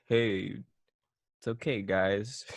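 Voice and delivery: lightly voiced laid-back teacher, low and soft; hint of an easygoing cool teacher; low-key, reassuring cool-teacher voice. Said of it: "Like that cool teacher vibe".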